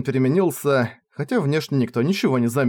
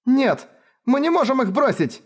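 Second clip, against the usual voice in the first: desperate protest; 12 semitones higher than usual. Acted. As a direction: refuse to leave them.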